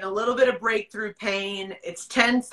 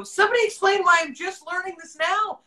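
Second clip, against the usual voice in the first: exasperated demand; 9 semitones higher, 3 dB louder, faster than usual. Retold or imitated. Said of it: "And I go".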